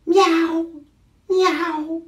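high, loud meows; cat meow. Shown as a demonstration. Reader leading children in meowing like this cat. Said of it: "and meowing like this cat".

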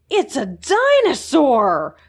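small hamster amazed at a dinosaur; Fergus in awe. A narrator's reading. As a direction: let the wonder stretch the words.

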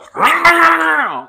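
sudden high shrieking laugh; a squealing cackle of disbelief; loud laugh. Real, not acted. Loud laugh burst mid-story.

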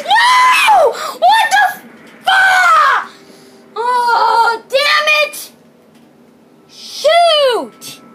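high, loud howl of frustration; enraged screaming. Real, not acted. Player losing when so close to winning, furious screaming. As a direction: explode into a drawn-out yell of defeat. Loses just short of winning.

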